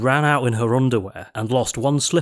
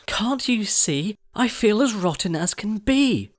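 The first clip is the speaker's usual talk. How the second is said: whiny Cinderella; 11 semitones higher than usual.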